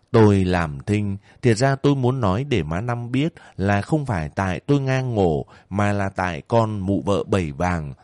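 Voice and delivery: plain reading voice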